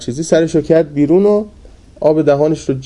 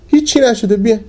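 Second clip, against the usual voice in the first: calling out; 8 semitones higher than usual. Retold or imitated. Sheikh reassures students again.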